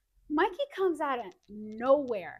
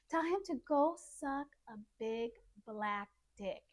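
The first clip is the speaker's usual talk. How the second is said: deliberate dictation; 5 semitones lower, 6 dB quieter than usual. Retold or imitated. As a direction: dictate it word by word, softly. Friend dictating a rude text.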